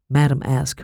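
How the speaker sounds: plain reading voice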